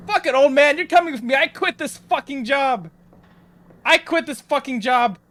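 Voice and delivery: high, loud, frantic quitting rant; frantic outburst. Real, not acted. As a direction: blurt it out in a rush of fear. Fleeing the game job in panic.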